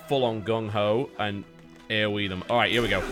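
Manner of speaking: normal talking voice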